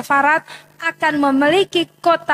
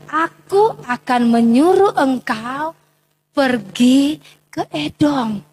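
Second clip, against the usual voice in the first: slow, lower commanding voice of God; 3 semitones lower, slower than usual. Acted. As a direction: hand down the command slowly. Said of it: "Ini Tuhan bilang". (suara Tuhan memerintah, pelan dan rendah)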